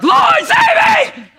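very high, loud drowning shriek; panicked scream. Shown as a demonstration. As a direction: scream for rescue, stretched out in hammed-up panic. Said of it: "It's probably more like".

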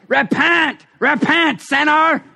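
a hint of a hellfire preacher, lightly hammed; mock angry preacher. Mocking the angry preacher's anthem, lightly hammed. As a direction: parody the angry street preacher. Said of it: "like the angry preacher's, you know, anthem".

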